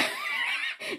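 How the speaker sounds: high-pitched giggle; high laugh. Acted. Character laugh in clip.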